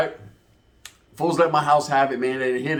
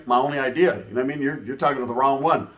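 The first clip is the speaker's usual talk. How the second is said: usual speaking voice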